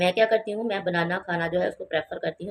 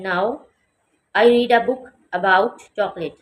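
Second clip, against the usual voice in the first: very slow and louder, every word spaced; slow, loud model reading; 7 dB louder, much slower than usual. Shown as a demonstration. Teacher modelling the example sentence loudly and slowly. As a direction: read it out loud and slow for the class. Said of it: "teacher models sentence".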